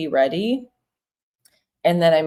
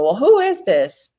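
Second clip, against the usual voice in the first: higher and louder, a wary "who is this?"; suspicious callee; 6 semitones higher, 5 dB louder than usual. Retold or imitated. Imitating a wary callee picking up a cold call. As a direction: play the suspicious stranger answering the phone. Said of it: "And they go".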